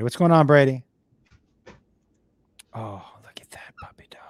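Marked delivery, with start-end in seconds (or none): 2.7-4.2 hushed whisper to a puppy. Real, not acted. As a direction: whisper softly at the dog. Cooing over the dog.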